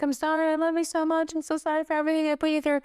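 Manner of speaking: high, rushed weepy apology; imitated crying. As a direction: imitate someone apologizing through tears. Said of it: "he can't stop crying".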